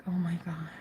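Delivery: breathy whispered awe. Real, not acted. Telling viewers about hugging her date, whispering in awe. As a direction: whisper it like you can't believe it.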